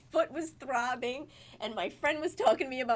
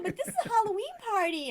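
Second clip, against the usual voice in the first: high, quick, lightly tipsy bartender; high-pitched tipsy protest, lightly mocked; drunk bartender; 12 semitones higher, faster than usual. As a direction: imitate a tipsy woman objecting. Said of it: "drunk somehow".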